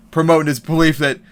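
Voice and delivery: normal talking voice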